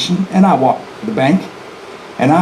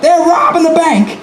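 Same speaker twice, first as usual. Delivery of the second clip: yelling alarm; 12 semitones higher than usual. Retold or imitated. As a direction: yell it down the street. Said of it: "yells out".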